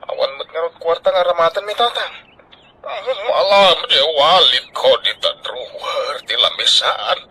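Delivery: ordinary talk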